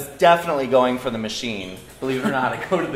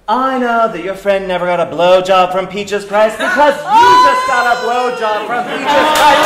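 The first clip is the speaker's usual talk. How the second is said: rising voice; 7 semitones higher, 8 dB louder than usual. Retold or imitated. Exposing the lying guy in the doorway, voice rising to a loud shriek. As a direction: build louder and higher into an outraged shout. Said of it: "Your voice sort of rises up".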